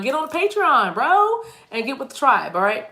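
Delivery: comic high exhortation; playful urging. Pretend. Mock urging viewers to join.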